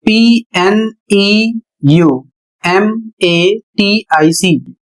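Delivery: पीएनईयूएमएटीआईसी spelled letter by letter, very slow; spelled out slowly. Shown as a demonstration. Teacher spelling the word slowly for students. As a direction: read each letter out slowly. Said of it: "अगला शब्द है".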